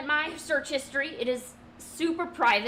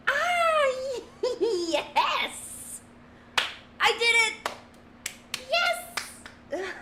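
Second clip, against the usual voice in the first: much higher, louder, laughing cheers; joyful cheering; 12 semitones higher, 3 dB louder, much slower than usual. Real, not acted. Cheering herself after nailing the drawing, laughing. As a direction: celebrate like you just won.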